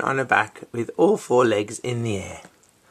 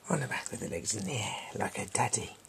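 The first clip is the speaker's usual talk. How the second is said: quiet aside; 9 dB quieter, faster than usual. Real, not acted.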